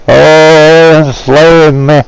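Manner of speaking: sneering imitation of someone complaining; mock whining. Ranting vlogger mocks people he says whine, in a high whiny voice. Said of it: "that whine around hard".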